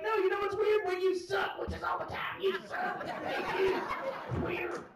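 high, shouted jeers; mock heckling. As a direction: imitate a drunk crowd drowning out the comic. Imitates crowd heckling the comic.

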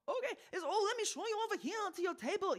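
high, fast fussy host voice; jolly chef voice. Retold or imitated. The chef showing us to our table, high and hurried. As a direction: rush them to their table like a giddy waiter. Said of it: "he literally goes".

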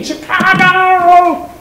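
high, slow, loud call of the name; drawn-out exasperated call. Acted. Teacher calls out exasperated.

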